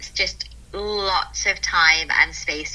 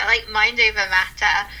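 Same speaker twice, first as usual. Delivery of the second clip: ordinary talk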